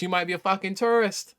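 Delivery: ordinary talk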